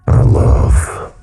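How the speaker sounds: slow, rasped, gurgling monster voice; lightly voiced diseased creature gurgle; diseased raspy gurgle. A narrator's reading. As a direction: gurgle it like a rotting throat. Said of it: "gurgled in a diseased, raspy voice".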